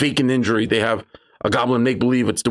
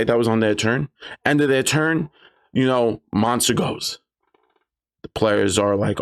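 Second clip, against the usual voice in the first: ordinary talk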